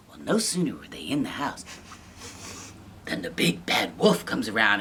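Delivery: hushed whisper building suspense. A narrator's reading. Storyteller whispering to children, hushed suspense. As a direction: whisper it to the kids. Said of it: "storyteller whispers suspense".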